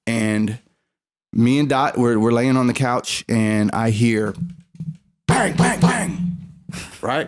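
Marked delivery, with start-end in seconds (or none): 5.3-6.4 three high, punched bangs; imitated door bangs. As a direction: mimic sharp banging on a door.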